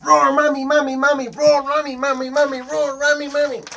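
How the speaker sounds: high, loud pestering chant; roaring little dinosaur, excited. Acted. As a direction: pester with rapid high roars.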